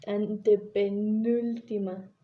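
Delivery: "antepenúltima" slowly, syllable by syllable; drawn-out, careful "antepenúltima"; slow sounding-out. Shown as a demonstration. Teacher carefully sounds out a hard term.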